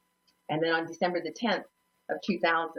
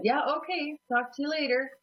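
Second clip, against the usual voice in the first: high, put-on casual goodbye; retold too-breezy sign-off; casual brush-off; 8 semitones higher than usual. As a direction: wrap up the call in a high, airy voice. Covering shock, ending the call.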